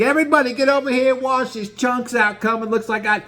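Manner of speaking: high, gleeful play-by-play; Gino narrating. Retold or imitated. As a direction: narrate the puking like an announcer. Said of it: "He's narrating the whole thing".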